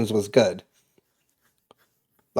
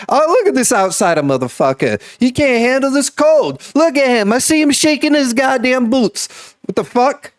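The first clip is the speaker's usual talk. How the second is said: much higher, louder mock-tough local heckling; rowdy Massachusetts local; 11 semitones higher, 4 dB louder than usual. Mocking a newcomer in the cold, as a loud, high rowdy local. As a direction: razz the outsider like a loudmouth townie. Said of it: "how we probably would have said it in Massachusetts".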